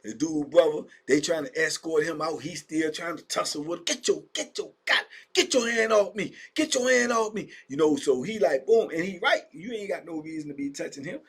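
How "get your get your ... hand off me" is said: angry protest. Retold or imitated. Brother resisting the officers.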